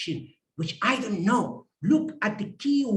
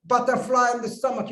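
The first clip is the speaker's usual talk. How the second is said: gloating, higher voice after a mock laugh; table topic master; 4 semitones higher than usual. Table topic master about to give people butterflies, mock gloating. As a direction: laugh "ha ha ha", then announce with relish. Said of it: "table topic master starts saying".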